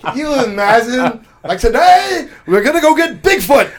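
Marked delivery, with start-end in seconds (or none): 1.6-3.7 high, loud rallying announcement; hammed-up crowd-rousing proclamation; mock rallying leader. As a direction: call the troops out on a silly quest. Rallying a crowd in invented scene.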